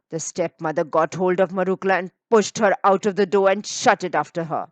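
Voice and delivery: ordinary delivery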